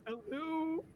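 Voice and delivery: tiny high-pitched, soft hello; small greeting voice. Acted. Game master voicing Penny greeting Lucius, tiny high voice. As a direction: squeak out a very high, quiet greeting.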